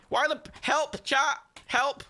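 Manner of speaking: high, urgent wail; pleading for help. Real, not acted. Gamer begs chat about wonky bricks, distressed plea. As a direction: beg in high-pitched distress.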